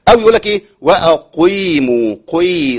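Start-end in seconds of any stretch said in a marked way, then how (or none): 0.8-2.2 "وأقيموا" drawn out slowly, its ق leaning toward ي; qaf bent toward ya. Shown as a demonstration. Teacher showing the wrong qaf mixed with ya. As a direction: stretch "وأقيموا" slowly, letting the ق slide toward ي. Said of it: "قي ده أنت كده عملت إيه".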